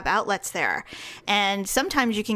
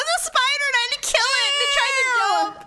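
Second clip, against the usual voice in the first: spider panic; 13 semitones higher, faster than usual. Real, not acted. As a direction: blurt out how the spider jumped at you.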